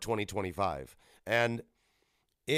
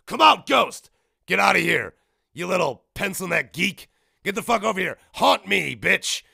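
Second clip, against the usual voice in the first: loud, raised-pitch goading of a ghost; mock taunting shout; 6 semitones higher, 9 dB louder than usual. Player at the Ouija board challenging a ghost, taunting shout. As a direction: dare it with hammed-up aggression.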